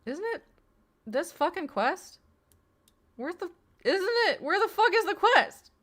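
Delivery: real frustration building into a laugh; exasperated searching. Real, not acted. Frustrated hunt for the quest.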